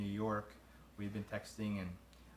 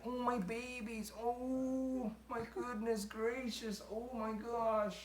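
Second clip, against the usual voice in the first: put-on high, drawn-out cooing baby talk; puppy mode voice; much slower than usual. Husband imitating his wife greeting the dogs, high slow puppy-mode voice. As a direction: imitate her gushing over puppies in a much higher voice. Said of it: "does her little voices when she walks in".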